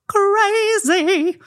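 high, drawn-out "crazy"; hammed-up crazy. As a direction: stretch the word out in a silly high pitch. Hammed-up word describing big families.

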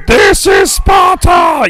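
high, drawn-out imitation roar; movie battle cry. Host quoting the movie three hundred to co-host, mock roar. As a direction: bellow it slowly, stretching each word. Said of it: "the messenger".

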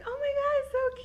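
high excited squeal; gushing squeal. Retold or imitated. Girlfriend thrilled by her boyfriend's gift, high gushing squeal. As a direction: squeal over a tiny cute gift. Retold reaction to guinea pig.

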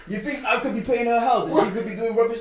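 loud, high, scandalised comeback; laughing-room banter, loudly indignant; incredulous protest. Real, not acted. Scoffs at listener's misunderstanding.

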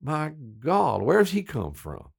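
mock Southern heckler; Southern guys. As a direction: imitate an appalled Southern local, pitch swooping up. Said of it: "that kind of thing".

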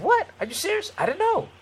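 high-pitched, incredulous disbelief; retold surprise. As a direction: replay being caught completely unaware. Retold surprise at suspended license.

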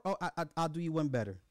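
ordinary delivery

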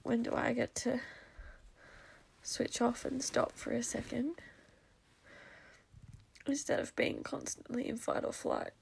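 very quiet, worn-out voice close to tears; barely holding it together after crying; tearful meltdown. Real, not acted. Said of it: "in that full-blown meltdown".

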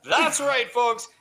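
high, slow, drawn-out announcement; game show host. Acted. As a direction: announce the title big.

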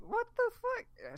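high, slow, freaked-out yelp; retelling her own scream as the log ride starts; terrified shriek. Retold or imitated. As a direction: squeal it in sheer panic on the ride.

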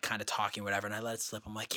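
normal talking voice

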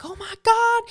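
very high, excited re-enactment; friend's shocked squeal. Retold or imitated. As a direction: squeal it in amazed delight. Voices friend's reaction to pregnancy test.

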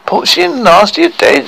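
high, shocked question; appalled. Acted. Girl hearing two dwarfs died, appalled. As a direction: voice leaps up in dismay.

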